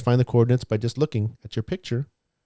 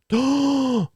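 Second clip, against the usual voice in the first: high theatrical gasp; teacher faking amazement at the answer, a high gasp; mock gasp. Mock discovery again.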